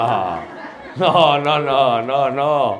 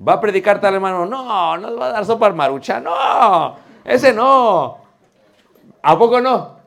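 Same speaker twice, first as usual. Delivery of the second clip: excited mock protest; 6 semitones higher, 7 dB louder than usual. Imitating congregation's disapproval.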